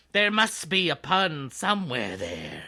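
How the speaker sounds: normal talking voice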